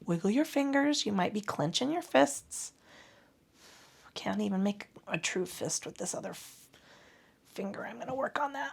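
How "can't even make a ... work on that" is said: quiet aside. Real, not acted. Muttered aside about own hand.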